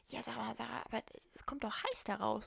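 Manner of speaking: soft, doubtful whispered objection; puzzled whisper. Retold or imitated. Parent asking daughter why the coffee comes out hot, whispering.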